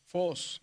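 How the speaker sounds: "Fos" con la O larga en arco; vocal alargada. Shown as a demonstration. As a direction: estira la O de "Fos". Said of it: "en el circunflejo se alarga". ("Fos" con la O sostenida que sube y baja)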